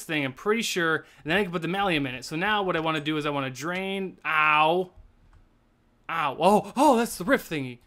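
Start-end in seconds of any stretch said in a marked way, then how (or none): none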